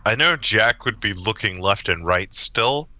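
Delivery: ordinary talk, nothing marked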